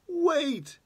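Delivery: sudden high squeak for the magic fish; the fish cries out to stop the blow; talking fish begging. Acted. Fish begs the fisherman to stop, sudden high squeak.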